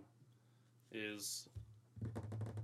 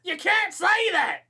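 high, loud Southern holler, drawn out; 12 dB louder, slower than usual. Acted. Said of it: "we can be like".

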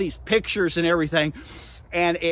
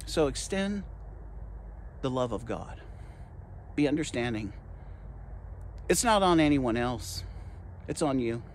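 low, soft and measured, with pauses; hushed appeal; 5 semitones lower, 8 dB quieter than usual. Real, not acted. Preacher urging the congregation to extend God's love, hushed appeal. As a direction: bring it right down after the climax. Gentle appeal after forceful climax.

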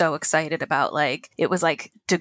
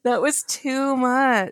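slow drawn out; 6 semitones higher, much slower than usual. Real, not acted. Mock exhausted.

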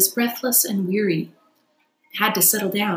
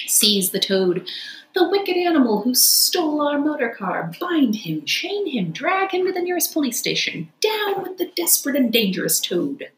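higher, indignant crowd cries; angry outcry; 7 semitones higher than usual. Acted. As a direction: denounce the wicked Toad. Party crying out angrily.

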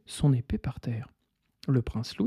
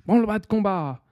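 raised rallying call; call to battle; 6 semitones higher than usual. Acted. Pirate chief rallying his crew, raised call.

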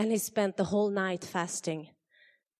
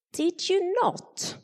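lightly voiced sly schemers, high; a hint of scheming courtiers pressing the king; accusing conspirators; 11 semitones higher, slower than usual.

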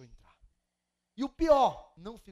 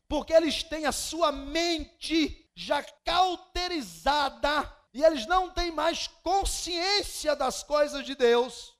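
fiery preaching; 7 semitones higher, 15 dB louder than usual. Acted. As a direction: thunder the verses out to the congregation. Preaching scripture at full force.

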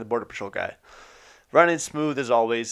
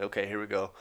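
resigned; 4 semitones lower, 9 dB quieter than usual. Retold or imitated. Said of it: "I'm like".

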